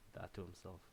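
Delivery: plain reading voice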